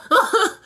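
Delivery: short high loud laugh; burst of laughter. Real, not acted. Laughs after his outburst.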